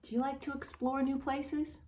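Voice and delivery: ordinary delivery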